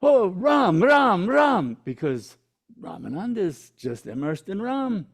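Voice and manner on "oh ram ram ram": loud, high cry of Ram, Ram, Ram; startled cry. Retold or imitated. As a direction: cry out the name as he's stepped on. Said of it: "and goes".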